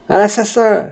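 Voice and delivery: high-pitched call for help, no real shout; cry of alarm. Acted. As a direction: raise a theatrical alarm. Crying out an alarm over a killing.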